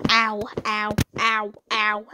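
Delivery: normal talking voice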